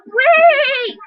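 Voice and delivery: high-pitched mock scream, held back in volume; squealed call, not full force; retold scream. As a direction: imitate a desperate yell to stop someone. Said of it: "screaming at the top of my lungs".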